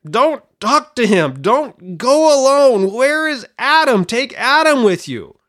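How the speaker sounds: high, loud warning cries; mock shouting at screen. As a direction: yell a warning to Eve like a movie audience. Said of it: "that shouting at the screen".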